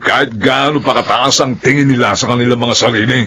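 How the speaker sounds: usual speaking voice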